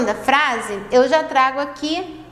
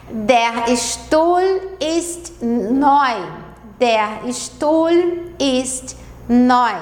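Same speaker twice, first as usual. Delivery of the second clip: Der Stuhl ist neu, drawn out very slowly; slow model sentence; much slower than usual. Shown as a demonstration. Teacher modelling German for beginners, very slow. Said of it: "Vou ler mais uma vez as quatro frases".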